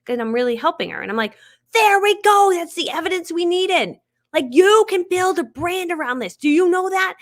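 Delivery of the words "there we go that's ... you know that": much higher and louder, slow triumphant cheer; excited cheer. Retold or imitated. Cheering a friend after a grateful comment, excited cheer. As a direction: celebrate the proof out loud.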